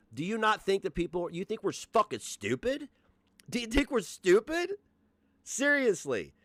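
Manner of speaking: high-pitched, incredulous challenge; indignant. Real, not acted. Streamer confronting Gigi over her community's narrative, indignant.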